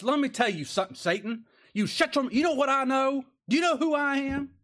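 high, loud, puffed-up confrontation; railing accusation. Acted. Preacher acting out Michael railing at Satan, indignant. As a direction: rail at an enemy, pitch climbing to the challenge. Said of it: "he durst not bring a railing charge".

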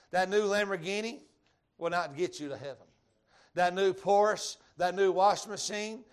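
emphatic sermon cadence; preaching at full force. Acted. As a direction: drive the point home. Preaching, louder peaks.

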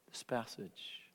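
a sudden whisper on this passage. Real, not acted. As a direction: drop to a whisper to draw them in. Preacher drops to whisper.

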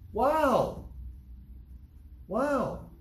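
high, ringing cry of wonder; amazed wow. Acted. Awe at changed destination.

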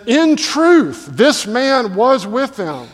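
high, loud, then slowing to the end; a man with zero doubt pointing at Peter; insistent accusation. Acted. A self-assured bystander accusing Peter, loud certain insistence. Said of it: "And so he insists".

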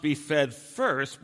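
very slow, each word of "be fed first" spaced, "first" weighted; slow, deliberate weight on "first"; stress on first. Shown as a demonstration. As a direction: draw out "be fed first" and lean on "first". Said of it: "his emphasis on the word first".

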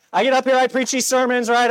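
ordinary talk, nothing marked

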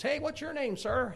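high-pitched retold child's voice; little kid. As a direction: pipe up like a small boy. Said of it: "some little kid comes by".

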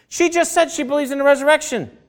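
high-pitched incredulous outburst; exasperated disbelief. Acted. Preacher reacting to believers who mourn without hope, incredulous. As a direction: protest it high, in acted disbelief.

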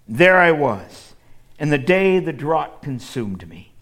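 dramatic Bible reading; scripture reading. Acted. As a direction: proclaim it like Jacob pleading his case. Reading Jacob's words with force.

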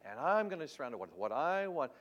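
hammed-up parody of her demand; scornful imitation of the lady's wish; mocking quote. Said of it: "when you say".

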